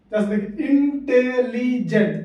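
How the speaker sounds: इंटेलिजेंट said slowly, split into four beats; syllable by syllable. Shown as a demonstration. Teacher counting out the syllables of इंटेलिजेंट slowly. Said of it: "चार बार में आ रहा है बोलने में ना".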